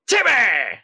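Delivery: high cry of Timmy; high-pitched Timmy shout. Retold or imitated. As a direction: blurt it out like Timmy. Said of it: "and they're saying".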